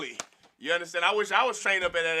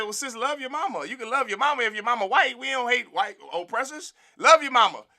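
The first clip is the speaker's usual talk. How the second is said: high, coaxing mock reassurance; mock sweet advice; 7 semitones higher, 5 dB louder than usual. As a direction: talk her down gently, higher. Retold own advice to sister.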